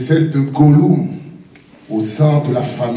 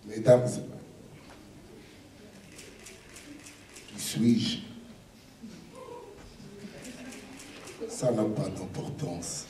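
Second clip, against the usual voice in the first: hushed dramatic; 4 semitones lower, 17 dB quieter than usual. Acted.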